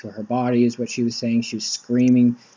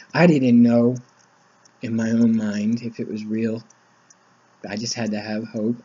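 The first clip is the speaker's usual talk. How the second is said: ordinary delivery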